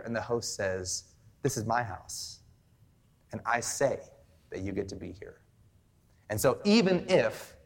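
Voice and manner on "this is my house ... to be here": low, soft, measured assurance; calm authority. Acted. Host welcoming a doubting guest, calm authority. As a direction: lower and soften it, leaving pauses.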